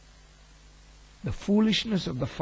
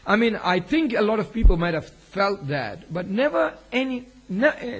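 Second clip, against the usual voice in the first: normal talking voice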